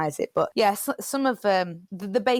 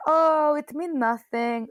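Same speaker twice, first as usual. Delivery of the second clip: slow, higher, imitating a Polish granddad; a lightly done Polish accent that drifts Russian; bad Polish accent; 7 semitones higher, slower than usual. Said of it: "my really bad Polish accent".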